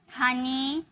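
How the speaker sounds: हनी ending in a drawn-out ee; pronunciation model. Shown as a demonstration. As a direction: model हनी as a lone word, ee at the end. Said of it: "हम इसे क्या बोलेंगे". (हनी के अंत में लंबी ई)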